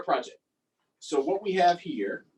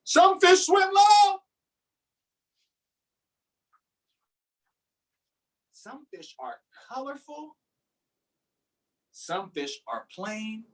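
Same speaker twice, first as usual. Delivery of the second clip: lively, sing-song picture-book reading; reading to children; 6 semitones higher, slower than usual. Acted. Teacher reading a fish book to her class, lively storytime voice. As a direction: read it out like storytime for little kids.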